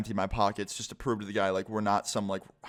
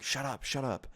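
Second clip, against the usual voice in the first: hushed retort; 2 semitones higher, 7 dB quieter than usual. Retold or imitated. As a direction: keep it hushed, as if someone might hear. Friend hushing him inside the house.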